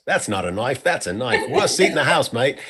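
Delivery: playful, hammed-up New Zealand accent. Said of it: "say it with a New Zealand accent".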